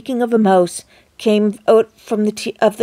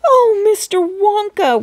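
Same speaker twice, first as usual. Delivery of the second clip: much higher, tearful wailing; 10 semitones higher, 3 dB louder than usual. A narrator's reading. Mother pleading with Wonka, tearful wail. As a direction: wail to him near tears. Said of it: "wailed Missus T V".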